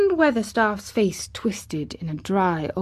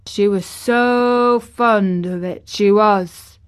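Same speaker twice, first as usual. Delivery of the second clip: put-on slow, lingering old gardener; drawn-out, louder reminiscence; slowly; 2 semitones higher, 7 dB louder, slower than usual. A narrator's reading. Ben remembers the boy's late mother, slowly. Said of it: "said Ben Weatherstaff slowly".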